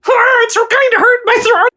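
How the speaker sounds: high squeaky cartoon mouse; Mickey Mouse voice. Mickey Mouse cheering up Goofy about the ice pick, high and squeaky. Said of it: "I'm Mickey Mouse".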